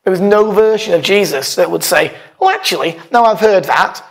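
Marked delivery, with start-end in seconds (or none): none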